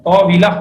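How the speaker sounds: طویلہ with a clear zer on the واو, ṭawīla not ṭāwila; correct pronunciation. Shown as a demonstration. Teacher correcting students' طاولہ, careful pronunciation. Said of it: "واو کو میں جان بوجھ کے زیر دے کے بولنا".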